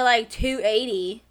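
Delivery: normal talking voice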